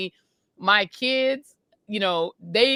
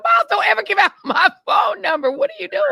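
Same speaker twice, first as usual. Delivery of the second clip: high, loud exasperated scolding; mock-scold him for giving out the number; imitating an exasperated mom on the phone; retold scolding; 12 semitones higher, 6 dB louder than usual.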